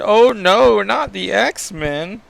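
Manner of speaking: high, drawn-out mock dismay. Villain mocks his helpless captive X-Man, mocking and exaggerated. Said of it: "Doctor Stratus mocks him".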